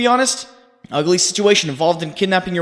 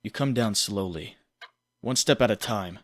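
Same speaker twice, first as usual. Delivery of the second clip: hushed narration; 5 semitones lower, 7 dB quieter than usual. Acted.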